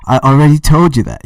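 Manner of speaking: mock English accent, near shouting; bad English accent. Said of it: "sorry if I'm doing a bad English accent".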